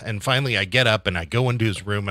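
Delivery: ordinary delivery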